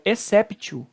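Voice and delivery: exceptio with a hard T, "esséptio"; mispronounced exceptio, T kept instead of an S sound; wrong T pronunciation. Shown as a demonstration. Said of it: "Exceptio também está errado".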